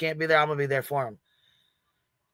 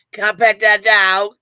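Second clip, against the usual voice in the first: higher, louder, slow mock-sweet plea; mock sweet ask; 5 semitones higher, 9 dB louder, slower than usual. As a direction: belt it out sweetly as a gag. Repeats the joke, laughing.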